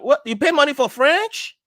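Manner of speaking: very high, loud, astonished protest; incredulous high-pitched outburst; shocked disbelief. Acted. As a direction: act out outraged astonishment, voice shooting up. Acting shock that French pays.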